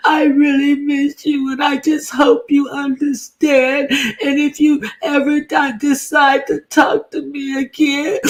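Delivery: high, hammed-up weepy pleading; overdone fake sob voice; mock teary plea. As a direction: beg like you're crying, way up high. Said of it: "teary cry".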